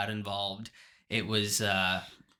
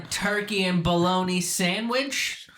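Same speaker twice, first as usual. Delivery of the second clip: higher, louder Boston deli pitch; Boston accent; 6 semitones higher, 4 dB louder than usual. Acted. Joining in on teasing Ted, mock Boston accent. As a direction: offer it in a hammed-up Boston accent. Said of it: "doing that accent".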